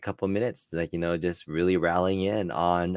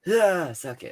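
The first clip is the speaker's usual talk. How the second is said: high drawn-out catchphrase yell; wrestler catchphrase; 13 semitones higher, slower than usual. Retold or imitated. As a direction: bark the catchphrase to the crowd. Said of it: "let me do my best".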